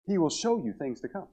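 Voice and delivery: a hushed second reading for emphasis; soft echo. Real, not acted.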